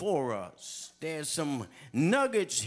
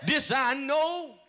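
higher, shouted testimony; shouted preaching; 6 semitones higher than usual. Acted. Preacher testifying to the congregation, shouting. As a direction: cry it out to the church.

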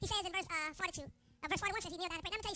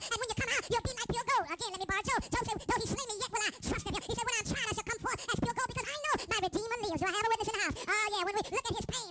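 louder, rising whoop building to a climax; preaching at full force; 4 dB louder than usual. Acted. Pastor pressing the congregation about praying through pain. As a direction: drive it home, building with each line.

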